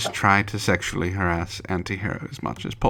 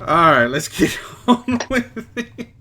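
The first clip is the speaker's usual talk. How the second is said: higher, louder, laughing; 10 semitones higher, 6 dB louder than usual. Real, not acted. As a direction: laugh through the words. Laughing mid-line.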